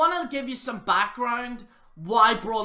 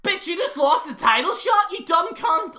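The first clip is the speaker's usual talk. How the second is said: mock shout; 9 semitones higher, 6 dB louder than usual. Voicing Vince mocking Braun.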